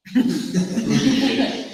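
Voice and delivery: risa alta y aguda tras la broma. Real, not acted. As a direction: suelta una carcajada aguda. Se ríe de su propia broma.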